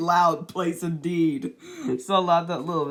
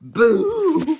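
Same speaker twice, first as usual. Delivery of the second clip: sudden boo, higher and louder; 12 semitones higher, 9 dB louder than usual. Acted. Little Miss Scary frightens people, high loud boo. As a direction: shriek the boo to scare. Repeated scare boos in story.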